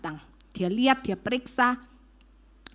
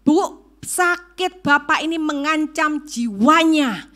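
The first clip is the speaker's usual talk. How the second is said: slow, loud, higher-pitched doctor's warning; emphatic warning; 4 semitones higher, 8 dB louder, slower than usual. Retold or imitated. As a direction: spell out the danger slowly and forcefully. Doctor's grave warning acted out.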